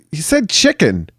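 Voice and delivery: put-on high-pitched kid voice; imitated son. Son telling dad what was said, imitated kid voice. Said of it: "And he's like".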